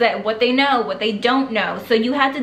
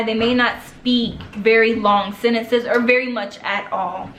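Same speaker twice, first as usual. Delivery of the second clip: ordinary delivery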